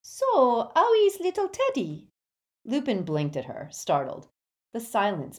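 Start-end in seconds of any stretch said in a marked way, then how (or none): 0.0-2.1 put-on high, loud French-accented chirp; bright French accent. A narrator's reading. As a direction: break the tension in a French accent. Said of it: "widely transparent attempt to break the tension".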